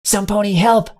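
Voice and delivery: panicked high yell for anypony; yelling for help. Acted. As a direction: shout it out in panic across the field. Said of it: "I realized I was yelling".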